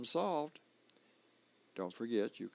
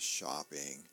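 lower, worn-out one-word answer; weary dad; 8 semitones lower than usual. Acted. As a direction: drop it low like an exhausted old man. Tired dad Earl answers.

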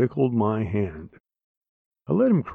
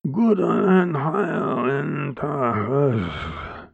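put-on very slow, higher slurred drunk shout; slurred drunk yell; 4 semitones higher, much slower than usual. Drunk uncle yells at girl, slow and slurred. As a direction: drag the words out, drunk. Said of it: "he yelled".

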